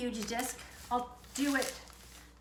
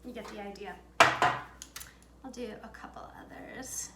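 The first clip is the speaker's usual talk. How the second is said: whispered aside; 2 semitones lower, slower than usual. Real, not acted. Quiet aside while cutting shapes.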